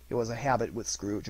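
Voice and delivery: ordinary delivery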